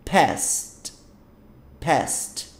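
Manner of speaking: "past" as one syllable, ending in a crisp st; correct pronunciation. Shown as a demonstration. Teacher showing how "past" is pronounced, one syllable. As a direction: say "past" with no E before the final t. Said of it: "olha a pronúncia".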